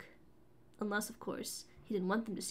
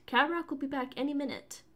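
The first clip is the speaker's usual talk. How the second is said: snappy; 6 semitones higher, 4 dB louder than usual. A narrator's reading. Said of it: "she snapped".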